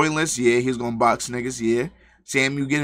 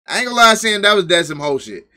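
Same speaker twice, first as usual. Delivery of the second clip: raised, high-pitched judgment; loud call-out; 7 semitones higher, 8 dB louder than usual. Real, not acted. Streamer to Sam about his message, loud incredulous call-out. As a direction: call him out at high volume, easing slightly.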